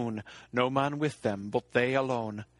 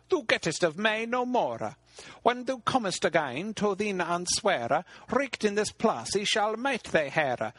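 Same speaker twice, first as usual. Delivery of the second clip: raised, insistent voice of the hag Ragnell; a bargaining hag pressing her price; loathly lady; 7 semitones higher than usual. Acted. Hag Ragnell pressing King Arthur, raised and insistent.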